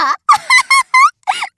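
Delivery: very high, loud rising laugh; shrill mocking laugh. Mistress shrieking with laughter at her pet, very high and loud.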